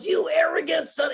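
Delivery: high, loud mock yell; mentor yelling. Mentor scolds him after his first talk, yelling. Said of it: "He started yelling at me".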